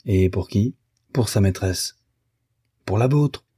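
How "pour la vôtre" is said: higher, sudden accusation; indignant cry. A narrator's reading. Wife accuses her husband, crying out indignantly. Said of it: "s'écria madame Arnould".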